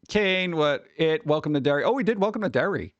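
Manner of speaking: ordinary talk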